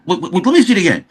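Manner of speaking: high, rapid imitated stammer; flustered astonishment. Fooled director demanding another look, flustered astonishment.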